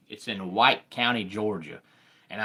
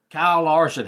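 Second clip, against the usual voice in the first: higher and louder on the name; excited name call; 7 semitones higher, 8 dB louder than usual. Real, not acted. Host hyping the championship drivers to viewers, excited. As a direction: announce the driver like a hype call.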